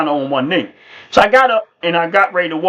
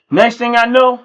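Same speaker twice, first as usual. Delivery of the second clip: ordinary delivery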